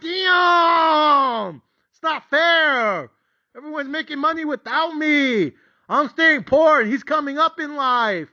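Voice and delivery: high, loud, crying complaint, drawn out; frustrated whine. Retold or imitated. Left behind by friend's gains, high crying frustrated wail. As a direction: wail it like life is unfair. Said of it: "I was mad, frustrated, irritated".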